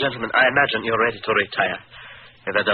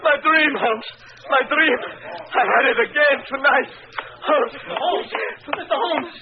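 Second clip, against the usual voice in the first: terrified calling; slower than usual. Acted. Count cries to Holmes in high panic. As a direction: call out in terror after the nightmare.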